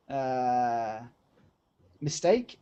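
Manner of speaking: ordinary delivery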